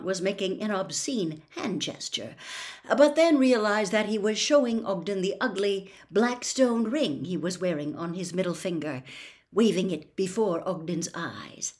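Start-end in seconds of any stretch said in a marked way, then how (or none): none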